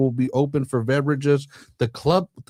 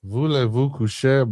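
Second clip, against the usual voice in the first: mock French. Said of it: "just kidding".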